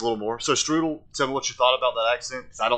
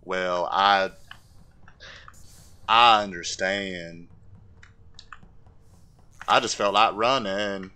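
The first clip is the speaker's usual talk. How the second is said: halting southern drawl; simple, earnest southern man; Forrest Gump; slower than usual. Acted. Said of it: "I gotta talk like Forrest Gump".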